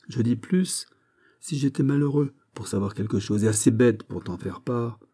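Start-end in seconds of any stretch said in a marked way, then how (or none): none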